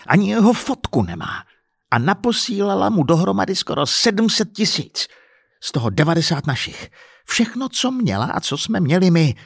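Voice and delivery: high-pitched, exasperated tally; worked-up, angry complaint; indignant rant. Acted. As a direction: list each loss with rising outrage. Husband rants about lost money.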